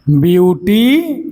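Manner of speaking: Beautiful slowly split, beauty drawn out, then फुल after a pause; syllable by syllable. Shown as a demonstration. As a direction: stretch out beautiful in separate parts for a class. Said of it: "यानी तीन syllable का है". (Beautiful को धीरे-धीरे तोड़कर बोलना)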